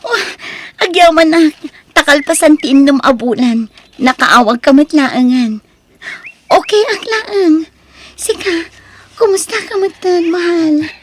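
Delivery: high, loud, overjoyed chatter; bubbling over with happiness on the phone; excited. Acted. Girlfriend overjoyed her boyfriend finally called, excited.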